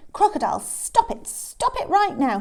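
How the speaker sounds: high, loud scolding order; urgent shout. Acted. Hippo to Crocodile gripping Elephant's nose, urgent shout. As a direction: order it to stop at once. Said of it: "shouted Hippo".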